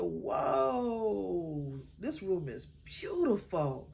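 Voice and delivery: awed delight. Retold or imitated. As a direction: gush over the beautiful room. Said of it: "I said".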